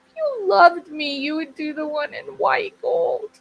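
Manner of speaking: high, pleading whine; whiny baby. Acted. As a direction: beg and whine like a spoilt child. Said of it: "She's gonna be a whiny baby".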